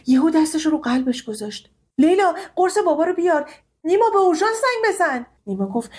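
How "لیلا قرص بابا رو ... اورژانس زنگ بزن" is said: urgent calling out. Acted. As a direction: shout for help in an emergency. Emergency, shouting orders. (فریاد اضطراری)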